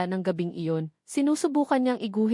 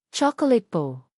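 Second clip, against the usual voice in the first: put-on hesitant child; 3 dB louder, slower than usual. A narrator's reading. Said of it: "Nag-aalangan man ay sumagot din ang bata".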